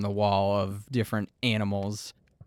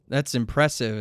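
ordinary talk

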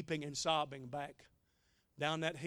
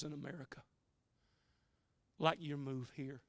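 tender plea; 4 dB quieter than usual. Retold or imitated. Retold pleading prayer to God.

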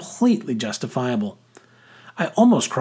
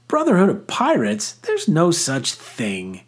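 sharp retort; 6 semitones higher than usual. A narrator's reading. Girl dismissing the pirate club, sharp retort. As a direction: scoff and shut it down. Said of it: "came the sharp retort".

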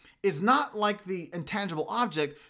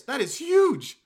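ordinary delivery

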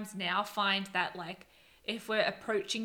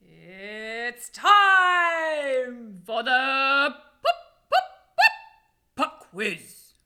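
stretched, loud jingle building to high pops; game show announcer; 4 semitones higher, 13 dB louder, much slower than usual. Acted. Host introducing the pop quiz segment, showman style.

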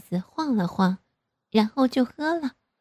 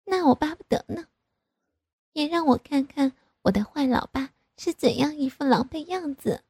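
much higher, impish taunting; mischievous daughter; 6 semitones higher than usual. A narrator's reading. Daughter taunting her father, higher impish voice. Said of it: "一副发坏的样子说".

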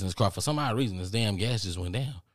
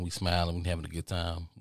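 ordinary talk, nothing marked

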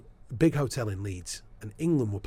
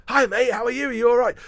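bright greeting pitched much higher and louder; mock cheery greeting; 13 semitones higher, 10 dB louder than usual. The footballer greets the reporter at Milton Keynes, a mock cheery high greeting. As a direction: play the jovial footballer saying hi, high and loud. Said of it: "I bumped into him".

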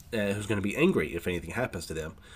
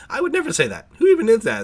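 very high, louder squeal of protest; mock shocked denial; 12 semitones higher, 8 dB louder than usual. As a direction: gasp it out in a much higher, louder squeak. Tokiyuki denies again, indignant.

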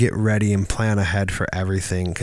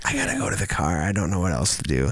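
softer and lower, hurried; nervous retreat; 6 semitones lower, 7 dB quieter, faster than usual. Retold or imitated. To himself at the auction, soft nervous hurry. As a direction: mutter your escape plan fast.

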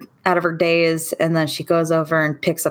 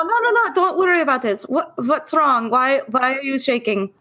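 worried friend; 8 semitones higher than usual. Acted. Elsa voiced on the phone.

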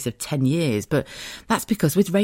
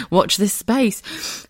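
giggling; 10 semitones higher, 3 dB louder, much slower than usual. Real, not acted. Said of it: "a full-on giggling fit".

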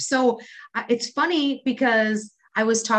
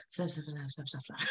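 much softer, mom hushing her daughter; near whisper; 6 semitones lower, 17 dB quieter, faster than usual. Retold or imitated. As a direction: hiss it barely audible, embarrassed. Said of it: "talk super soft ... almost whispering".

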